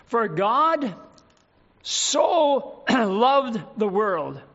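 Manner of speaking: so drawn out and leaned on, very slow; stress on so. Shown as a demonstration. Preacher reading the verse, dwelling on so. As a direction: linger on the word so. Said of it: "Emphasis on that little word".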